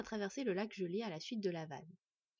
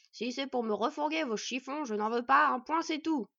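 loud, higher, grumpy male dwarf; slightly hoarse yelp; 4 semitones higher, 8 dB louder than usual. A narrator's reading. Dwarf to a caller through the door, cross yelping. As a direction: yelp it to send the caller away. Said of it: "glapit une voix masculine et légèrement éraillée".

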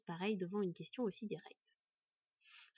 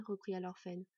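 usual speaking voice

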